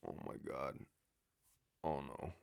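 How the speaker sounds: breathy whispered oh my God; hushed, half-joking alarm; mock dismay whisper.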